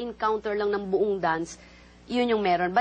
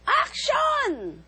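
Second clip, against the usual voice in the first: high, loud action call; director's call; 4 dB louder than usual. Retold or imitated. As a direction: call it from up above.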